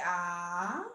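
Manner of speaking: soft ah. Shown as a demonstration. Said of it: "we say".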